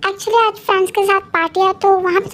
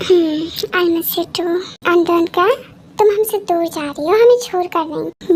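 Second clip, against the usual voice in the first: normal talking voice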